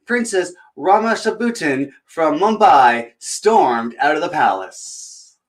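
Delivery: usual speaking voice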